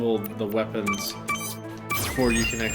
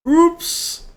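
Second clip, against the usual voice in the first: playful high oops; 8 dB louder than usual. Real, not acted. Falls off the level edge.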